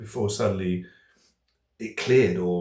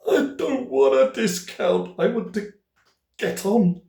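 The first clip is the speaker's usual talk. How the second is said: high, strained mock protest; hammed-up desperate plea, pitched up and loud; exasperated pleading; 13 semitones higher, 6 dB louder, slower than usual. Impatient caller tells phone operator to get on, exasperated pleading.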